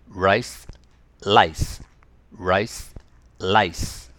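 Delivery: Rice with a curled R, lice with a tongue-tip L; R and L minimal pairs. Shown as a demonstration. Teacher drilling rice and lice. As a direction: contrast the first sounds. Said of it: "はい、一緒に。".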